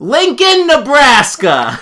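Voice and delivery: high, slow, drawn-out announcement; triumphant shout. Real, not acted. Player proving they know capitals, triumphant. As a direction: call it out proudly, stretching it.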